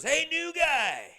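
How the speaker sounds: high, loud, slow mock yell at the new guy; loud drunk regular. Dopey drunk barfly hollering at newcomer, high loud mock yell. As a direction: ham up a dopey barfly hollering across the bar.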